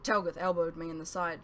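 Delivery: ordinary delivery, nothing marked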